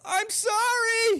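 very high, slow mock wail; random yell. Randomly yelled to a friend as an inside joke, wailing yell. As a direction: holler it as a hammed-up running joke. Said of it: "will randomly yell".

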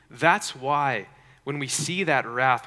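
normal talking voice

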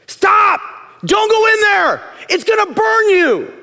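high, loud warning shout; full-voiced parent's alarm shout; urgent yell. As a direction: yell to stop a child in danger. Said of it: "You yell, right?".

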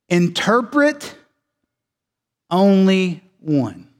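slow, weighty, each word set apart; emphatic Greek word order. Shown as a demonstration. Preacher stressing the Greek to his church, slow and emphatic. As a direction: lean hard on "Interpret" and "only one". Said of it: "the Greek is emphasizing this".

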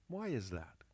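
ordinary delivery

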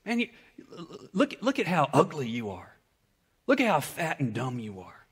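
a quiet mocking put-down; inner voice of shame. Preacher voicing shame's insults to listeners, softly mocking. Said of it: "Shame's the voice that says".

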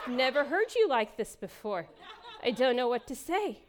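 half-laughing, amused disbelief; laughing while quoting. Retold or imitated. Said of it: "she's going".

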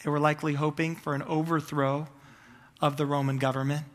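normal talking voice

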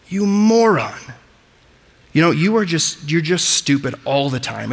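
a touch of sneering scorn; mock insult. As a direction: scoff at him like he's worthless. Acting out the moros insult.

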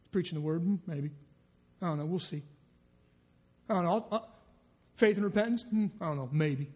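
a doubter shrugging off faith; cynical doubt. Acted. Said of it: "we just get cynical".

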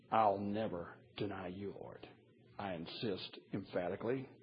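low, soft quoted pledge; understated vow. Retold or imitated. Preacher recalling Peter's vow to the Lord, quiet and understated. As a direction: say the boast quietly, well below full voice.

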